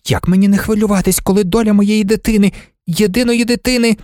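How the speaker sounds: high, fast, worried pleading; mother's alarmed, rising plea; anxious mother. A narrator's reading. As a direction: rush it out like a frightened mother. Anxious mother pleads about daughter.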